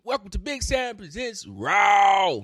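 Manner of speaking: loud, high announcer holler; big drawn-out show-opening yell; hype intro. Pretend. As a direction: shout the show title, stretching out RAW. Hammed-up podcast intro.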